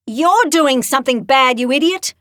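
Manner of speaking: loud, high angry bark. Acted. Stevenson barking at the armed boy, angry and loud. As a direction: snap the insult at him hard. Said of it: "Stevenson barked".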